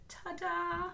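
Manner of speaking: high sing-song ta-da; bright playful ta-da; cheery ta-da. Real, not acted. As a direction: present the graph with a flourish. Showing a finished graph.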